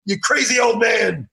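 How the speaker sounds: hammed-up outrage, half laughing; mock outraged yell. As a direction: yell at him for spoiling the ending. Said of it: "and I go".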